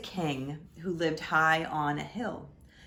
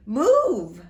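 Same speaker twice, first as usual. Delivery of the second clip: loud, higher urgent shout; 7 semitones higher, 13 dB louder than usual. Acted. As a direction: yell it to clear the way. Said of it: "they shouted".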